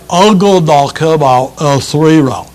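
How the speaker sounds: lightly voiced dumb challenger; a hint of a slow punch-drunk fighter; dim boxer voice. Voicing dim boxing challenger.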